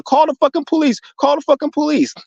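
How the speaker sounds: high-pitched imitation of a frantic clerk; mock panicked clerk. Imitating the store clerk calling for police, mock panicked high voice.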